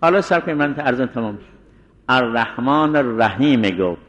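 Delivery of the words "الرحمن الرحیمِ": الرحیم ending in a voiced me; pause on the vowel. Shown as a demonstration. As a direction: sound a short e after the m of الرحیم. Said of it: "باز وقف کرد".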